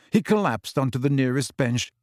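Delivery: plain reading voice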